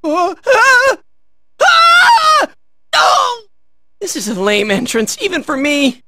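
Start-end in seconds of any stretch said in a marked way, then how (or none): 0.0-3.4 high, loud yell ending in "oh"; startled scream. Acted. Hero in trouble, high startled screaming.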